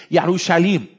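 Yerushalim with a Y and sh; Hebrew pronunciation. Shown as a demonstration. Pastor giving the Hebrew form of Jerusalem, Yerushalim. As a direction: say Yerushalim, ye-ru-sha-LEEM. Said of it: "If I were to pronounce Jerusalem".

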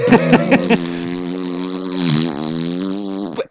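long, low sputtering engine sound; drawn-out mouth-made vehicle noise; imitated fartmobile noise. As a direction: buzz out a low comic motor drone.

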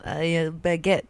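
halting mock French accent; badly faked French. Shown as a demonstration. Host testing lip trick, mock French accent. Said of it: "if you do wanna speak French".

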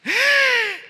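sharp high gasp of alarm; shocked high gasp. Retold or imitated. Mother gasping at her son's claim, high and sharp. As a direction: catch your breath in shock.

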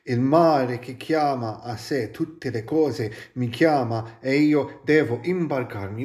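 measured, lyrical reading of Italian prose; Italian poetry recital; poetic reading. Acted. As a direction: read it like a solemn poem. Reads Gibran aloud in Italian.

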